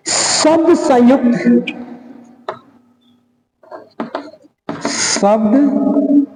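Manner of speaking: drawn out, almost chanted; slow sing-song dictation. Shown as a demonstration. Teacher dictating the second rule, sing-song and slow. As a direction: chant the words slowly for copying. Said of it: "dictating slowly, half sung".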